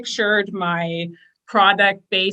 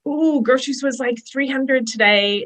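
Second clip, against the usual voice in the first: higher, lightly mimicked fretting over groceries; a touch of imitated scarcity whining; mock money worry; 5 semitones higher than usual. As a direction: play the old worried texter, half-joking. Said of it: "being like".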